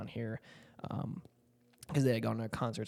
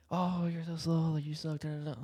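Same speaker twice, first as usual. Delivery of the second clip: mock taunting; 5 semitones higher than usual. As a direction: sneer like a bratty critic. Mocking an impatient sibling.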